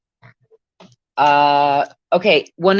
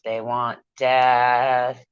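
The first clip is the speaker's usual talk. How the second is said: slow ominous intoning; 4 semitones lower, much slower than usual. Pretend. Mock ominous pronouncement.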